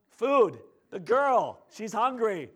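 acted impatience, words punched loudly; emphatic repeat. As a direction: spell it out forcefully for a slow listener. Louder repeat after listener's "Huh?.

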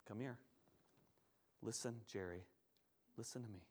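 low, hushed, tender voice; quiet, intimate comfort for someone grieving; gentle reassurance. Acted. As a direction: speak softly and soothingly, leaving space between phrases. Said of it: "so sensitive, so insightful".

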